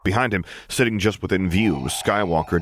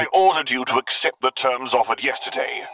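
higher, threatening snarl; angry Vader snarl; 7 semitones higher than usual. A narrator's reading. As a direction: snarl the order at the admiral. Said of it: "Vader snarled".

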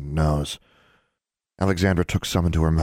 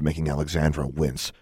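usual speaking voice

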